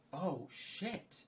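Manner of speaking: a high, sudden fake gasp of realization; hammed-up oh-no; mock surprise. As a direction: act floored by the idea. Reacts to his own plan.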